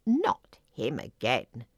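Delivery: low, slow grumble; complaining frog. A narrator's reading. Frog hears of the evil cranberry, complaining. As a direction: drag it out as a frog's complaint. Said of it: "complained Blossom".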